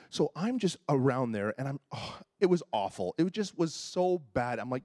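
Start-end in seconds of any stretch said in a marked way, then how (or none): none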